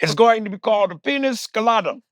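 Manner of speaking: put-on high, drawling old-man Cosby voice; Bill Cosby impression. Mocking Cosby to the co-host, high and drawling. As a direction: do Cosby, smug and sing-song. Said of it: "Bill Cosby".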